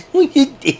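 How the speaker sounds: squeaky falsetto hi guys; high silly greeting. Pretend. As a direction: chirp it in a high goofy voice.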